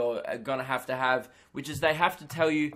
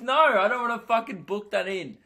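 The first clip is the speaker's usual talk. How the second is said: raised, higher mock outburst at the doctor; a half-joking loud no, higher and louder; indignant refusal; 6 semitones higher, 5 dB louder than usual. Patient refusing the hot doctor's booking offer, indignant raised voice.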